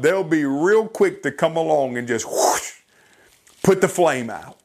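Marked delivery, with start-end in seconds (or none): 2.3-2.7 airy fire-extinguisher blast noise; imitated whoosh. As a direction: blow out a quick rushing whoosh. Imitates an extinguisher putting out flame.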